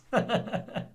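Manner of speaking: short high giggle; laugh. Real, not acted. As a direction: laugh at your own bit. Laughing at own joke.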